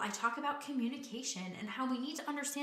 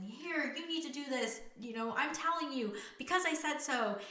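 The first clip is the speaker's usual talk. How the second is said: higher, mock bossy parent laying down the law; mock stern because-I-said-so voice; authoritative parent; 6 semitones higher than usual. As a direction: lightly play the strict parent giving orders. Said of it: "being the authoritative person".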